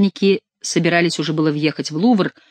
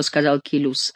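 ordinary delivery

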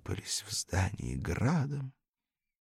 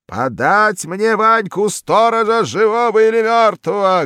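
thundering roar; 13 semitones higher, 12 dB louder than usual. A narrator's reading. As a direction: thunder it through the whole building. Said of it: "гремело школьное здание".

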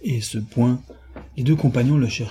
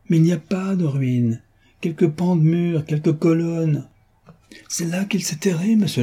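forceful cry; 6 semitones higher than usual. Acted. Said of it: "criait Beautrelet avec force".

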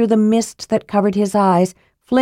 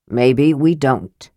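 lower, slow grim dwarf; 6 semitones lower, slower than usual. Acted. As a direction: say it slowly with grim resignation. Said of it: "Flint said grimly".

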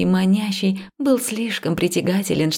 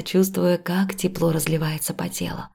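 plain reading voice

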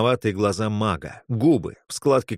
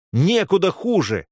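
higher, decisive retort; cutting retort; 9 semitones higher, 3 dB louder than usual. A narrator's reading. Friend cutting off the girl's doubts, cutting retort. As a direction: cut her doubts off. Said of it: "отрезал Виктор".

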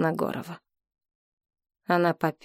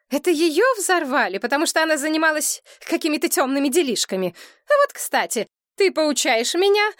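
heated quarrel voice; 9 semitones higher than usual. A narrator's reading. Quarrel between mother and daughter.

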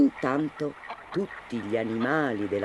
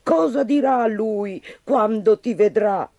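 worried exclamation; 6 semitones higher, 3 dB louder than usual. Acted. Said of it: "esclamò la madre".

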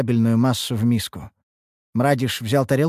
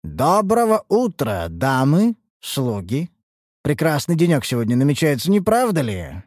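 higher, theatrical good morning to the servants; pleased master greeting his household; self-satisfied master; 5 semitones higher than usual. Acted.

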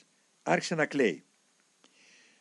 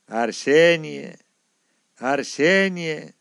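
slow, sighing and louder, name drawn out; 3 semitones higher, 7 dB louder, much slower than usual. Retold or imitated. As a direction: say the name twice on a heavy sigh. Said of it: "και αναστέναξε". (αργά, με αναστεναγμό)

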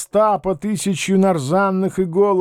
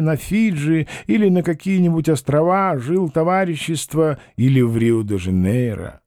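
ordinary delivery, nothing marked

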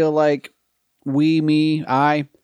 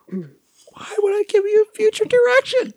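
much higher, slow put-on voice; arguing with myself. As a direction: play the other side of yourself, high. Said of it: "Do the thing".

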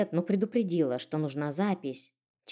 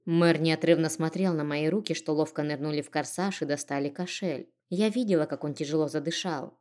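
ordinary delivery

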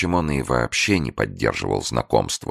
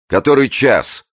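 sharp high shout from the dark; 13 semitones higher, 6 dB louder than usual. A narrator's reading. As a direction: bark the question. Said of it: "крикнула женщина из угла".

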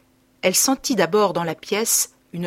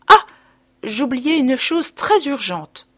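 higher, slow, a sudden mock remembering; sudden exclamation; 6 semitones higher, 4 dB louder, slower than usual. A narrator's reading. Mother making an excuse to leave the sickroom, sudden exclamation. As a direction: exclaim as if something urgent just came back to her. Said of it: "soudain s'écriait".

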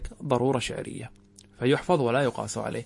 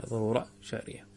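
quiet repeated reply; 4 semitones lower, 11 dB quieter than usual. Retold or imitated. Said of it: "قال".

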